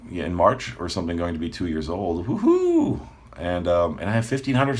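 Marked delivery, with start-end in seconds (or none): 2.3-3.0 excited cheer. Real, not acted. Channel turning two years old.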